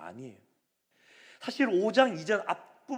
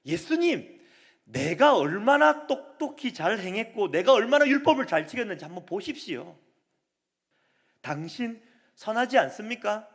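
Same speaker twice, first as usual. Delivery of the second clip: lightly voiced boastful official, a bit louder; proud official; 4 semitones higher, 6 dB louder than usual. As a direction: show off your good deeds, wanting praise. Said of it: "이 말의 내면에는 무엇이 깔려 있냐면".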